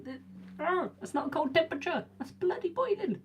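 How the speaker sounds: high-pitched mock outrage at the heat; squeaky outrage. Real, not acted. Outraged at the temperature.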